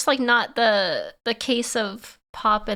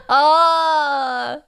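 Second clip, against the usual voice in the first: surprised exclamation; 4 semitones higher, 5 dB louder than usual. Real, not acted. Sudden realisation.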